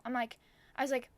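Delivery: normal talking voice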